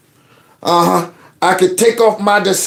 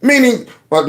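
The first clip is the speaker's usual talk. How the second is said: ordinary talk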